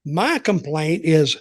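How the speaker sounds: usual speaking voice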